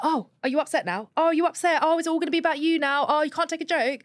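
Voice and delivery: high, sing-song mimicry of a sneering boyfriend; mocking taunt. Boyfriend taunting her for being upset, imitated mockingly. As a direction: imitate him belittling her, pitched well up.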